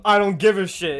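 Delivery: imitated teacher snapping loudly; mock angry teacher. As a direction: burst out like a fed-up teacher.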